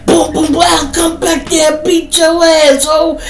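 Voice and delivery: put-on loud, high drunken shouting; going off. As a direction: rant and threaten at full volume. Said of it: "he just going off".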